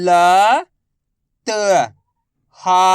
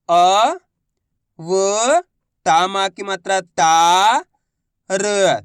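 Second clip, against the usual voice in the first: letters sounded one by one. Shown as a demonstration. Teacher showing how to read letter by letter. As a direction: spell it out for the class. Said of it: "देखो किस तरीके से".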